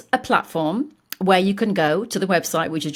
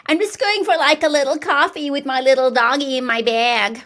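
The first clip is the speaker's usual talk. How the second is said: high, loud, hammed-up Californian accent; mock California girl with a handbag dog; 6 semitones higher, 5 dB louder than usual. Girl out with her little dog, mock Californian accent. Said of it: "my Californian impression, though, the girl".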